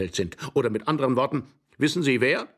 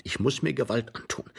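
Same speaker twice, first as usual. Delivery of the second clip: plain reading voice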